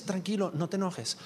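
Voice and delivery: softly spoken reassurance; calming. Acted. God to an upset believer, soft calming. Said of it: "Dios dice".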